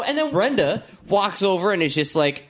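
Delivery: ordinary talk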